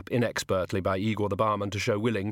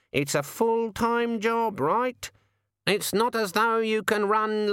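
much higher, slow teasing raven; 9 semitones higher, much slower than usual. Acted. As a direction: needle him like a cheeky bird. The raven lectures.

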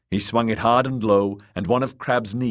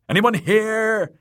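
slow, loud, high call; 6 dB louder, slower than usual. A narrator's reading. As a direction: call out searching the empty place. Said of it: "called Nimble Dick".

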